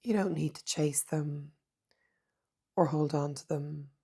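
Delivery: soft, unhurried meditation voice with long pauses; hushed, calm mindfulness guidance; guided meditation. Acted. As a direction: guide them gently inward, leaving space between phrases.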